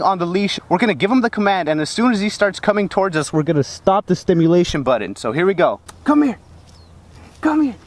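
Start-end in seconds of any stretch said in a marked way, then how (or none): 6.0-7.8 calling a dog. Real, not acted. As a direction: call the dog back firmly.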